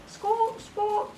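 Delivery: high repeated bird squawks; squawking. Acted. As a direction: screech twice like a parrot. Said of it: "Maria shouted".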